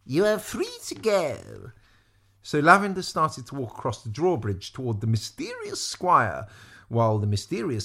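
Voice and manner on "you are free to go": high, slow proclamation; announcing. A narrator's reading. Maudmont freeing the princess, slow grand announcement. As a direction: declare it grandly, drawing it out. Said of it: "Maudmont announced".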